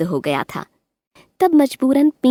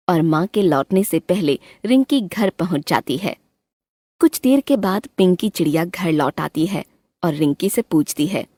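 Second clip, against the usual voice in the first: usual speaking voice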